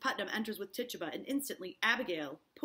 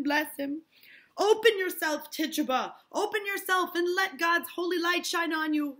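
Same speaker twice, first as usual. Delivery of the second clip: loud, rising preacher's blessing; fervent prayer; 8 semitones higher, 8 dB louder than usual. Acted. Hale fervently praying over Tituba. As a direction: pray over her with fervor.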